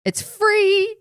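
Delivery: bright high squeal on free; thrilled, loud and high; excited. Real, not acted. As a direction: squeal it out like a bargain. Excited ad pitch.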